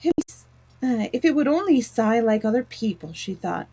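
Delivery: ordinary delivery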